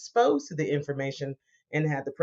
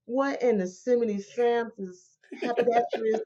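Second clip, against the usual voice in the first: pitched up in a put-on voice of disbelief; mock bewildered outburst; 8 semitones higher than usual. Client reacting to investment truths, mock bewildered. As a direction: play the flabbergasted client. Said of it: "I'm just like".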